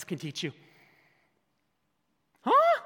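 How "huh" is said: squeaky high huh, much higher and louder; teasing the congregation with a high huh; mock puzzled huh. Real, not acted. Prompting congregation.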